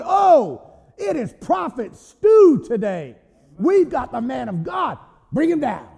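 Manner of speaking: hammed-up predator crowing; gloating lion. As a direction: relish the meal as a hungry lion. Acts gloating hungry lion.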